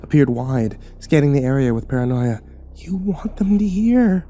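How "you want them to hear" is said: put-on urgent old woman. A narrator's reading. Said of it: "She shushed me loudly".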